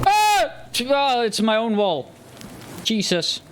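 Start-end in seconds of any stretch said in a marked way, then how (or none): 0.0-0.5 sharp high loud scream of fright; real startled scream. Real, not acted. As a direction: shriek as something jumps out. Startled by own wall.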